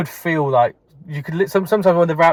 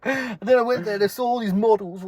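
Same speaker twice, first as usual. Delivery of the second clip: higher-pitched bragging bullshitter; Jay from The Inbetweeners; 6 semitones higher, 4 dB quieter than usual. Acted. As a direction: spin a boastful tall tale, voice pushed up. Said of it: "he's like Jay from In Between".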